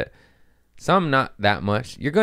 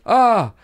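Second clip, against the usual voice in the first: panicked gasp; 7 dB louder than usual. Retold or imitated. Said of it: "I was like".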